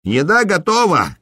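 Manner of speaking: high-pitched announcing call, narrated; innkeeper's call. A narrator's reading. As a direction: call out that food is ready, without full volume. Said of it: "крикнул корчмарь". (выкрик корчмаря повыше тоном)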